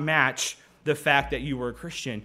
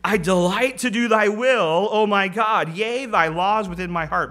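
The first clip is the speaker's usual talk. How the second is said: loud, higher, fervent declaration; zealous; 4 semitones higher, 5 dB louder than usual. Acted. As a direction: proclaim it with zeal, easing toward the end. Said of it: "David in his zeal says".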